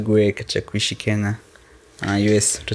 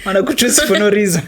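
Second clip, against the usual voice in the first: laughing; 10 semitones higher than usual. Real, not acted. Laughing about matatu conductors.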